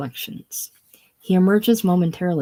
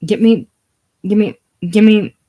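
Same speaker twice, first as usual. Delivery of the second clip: loud, high-pitched grabby demands; scornful mock begging; mocking greed; 4 semitones higher, 10 dB louder than usual. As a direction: mimic a needy grabber, loudly. [name] mocks Violet's grabbing demands.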